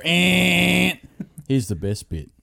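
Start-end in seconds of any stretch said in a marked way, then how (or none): 0.0-0.9 imitated printer buzz, held on one note; printer noise. Son mimics the printer going, imitated buzz. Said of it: "the printer to go".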